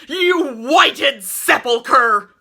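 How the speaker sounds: very slow, high, loud bellow; final furious bellow. A narrator's reading. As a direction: give one last shake with the words. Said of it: "he bellowed with a final shake".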